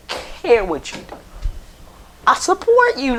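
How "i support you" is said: real voice crack. Real, not acted. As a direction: voice jumps way up and cracks. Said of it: "Why did my voice get so high-pitched".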